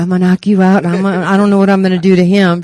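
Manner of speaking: loud, fast boasting; kid's boast. Retold or imitated. Daughter boasting to her mother, loud and fast. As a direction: shout the threat like a cocky kid. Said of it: "I said".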